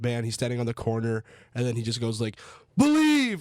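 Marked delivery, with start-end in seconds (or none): 2.8-3.4 sudden high, loud imitation shout; jump-scare yell. Old man on the corner startling passers-by, mock jump-scare yell. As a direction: yell it like a startling street crier. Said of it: "it just jump scares the crap out of you".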